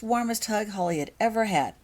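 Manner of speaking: ordinary delivery, nothing marked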